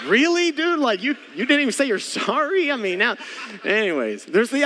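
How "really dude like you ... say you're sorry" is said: high-pitched, incredulous comic protest; mock exasperation. Dad to his popcorn-minded son, mock exasperation. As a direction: jump way up in pitch, playfully appalled.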